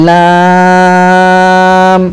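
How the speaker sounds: লাম held very long, three alif counts; লাম টেনে; drawn out lam. Shown as a demonstration. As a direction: stretch লাম slowly. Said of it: "তিন আলিফ পরিমাণ টান দিয়ে পড়তে হবে".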